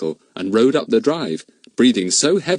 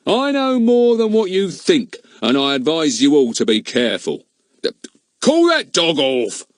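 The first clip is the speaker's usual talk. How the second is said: pompous policeman; 7 semitones higher, 3 dB louder than usual. Acted. Goon speaks in the story.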